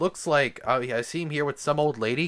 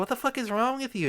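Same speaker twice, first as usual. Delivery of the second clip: much higher, sing-song mock Smurf invite; cheery Smurf voice; 11 semitones higher than usual. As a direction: play a sugary little Smurf asking to play. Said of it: "They go".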